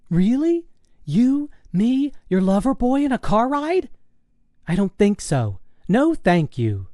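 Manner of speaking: put-on very high, disbelieving girlish voice; high-pitched daughter, appalled then refusing; incredulous teenage daughter. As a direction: scoff at Mom's offer in a high pitch.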